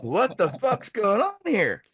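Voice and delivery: high-pitched incredulous question; mock bewilderment. Reacting to the official out of uniform, high incredulous voice. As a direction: act out baffled disbelief, pitched right up. Their baffled reaction, acted.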